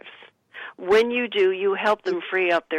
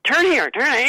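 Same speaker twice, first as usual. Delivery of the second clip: sharp mock yell of a bossy passenger, higher and louder; 7 semitones higher, 4 dB louder than usual. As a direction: bark the order like a controlling man at the wheel. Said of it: "when they start yelling".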